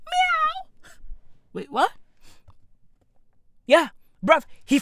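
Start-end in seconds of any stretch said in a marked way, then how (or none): 0.1-0.9 high, soft mock meow; imitated baby meow. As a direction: mimic a little cat-like cry. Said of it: "he yells".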